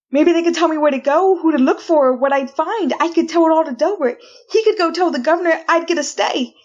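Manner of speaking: racing hope that tips into a laugh; frantic hope. Acted. Condemned woman in her cell, frantic hopeful rush.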